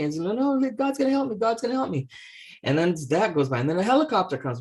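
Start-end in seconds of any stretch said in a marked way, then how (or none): 0.2-2.1 stubborn refusal. Acted. Priest refuses the boat.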